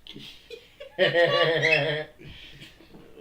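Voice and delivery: long, loud, high burst of laughter. Real, not acted. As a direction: let out a big goofy cackle. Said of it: "you're doing that stupid laugh".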